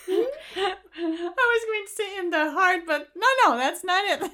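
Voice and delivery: high, laughing through words. Real, not acted. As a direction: giggle while saying it. Laughs at her own joke.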